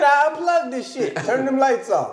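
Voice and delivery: mocking mad cohost. Teasing his cohost as mad, mock huffy. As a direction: order it shut down in a huff. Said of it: "He's like".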